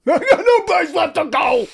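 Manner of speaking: high, strained mock Richard Gere cry; Richard Gere impression. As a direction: overact it like a terrible movie scene. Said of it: "is comically terrible".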